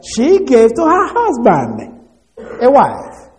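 "husband" and "wife" pitched high and drawn out; emphatic stress on wife. Shown as a demonstration. Preacher showing the congregation the repeated word, emphatic stress on "wife". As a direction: lean hard on "wife", starting high and falling. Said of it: "It keeps emphasizing the word wife".

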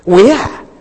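"We are" said firmly as a standalone assertion; emphatic. Retold or imitated. Preacher voicing the author's emphasis, emphatic. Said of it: "he wants to be emphatic".

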